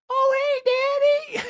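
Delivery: silly impression of the turkey's kid; turkey son voice. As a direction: imitate a goofy little creature greeting its dad. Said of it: "the son sounds like".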